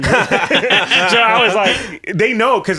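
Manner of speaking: high and loud through laughter; laughing. Real, not acted. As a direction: talk while cracking up. Laughing while telling.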